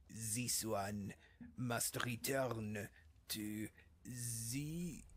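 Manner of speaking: put-on slow, drawn-out French Khajiit accent. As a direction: play a cat-folk merchant with a French Khajiit accent, taking his time. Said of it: "I do the French Khajiit accent again".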